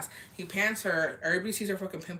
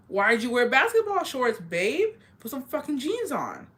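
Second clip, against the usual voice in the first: sassy scolding; 6 semitones higher than usual. Retold or imitated. Scolding the girl about shorts.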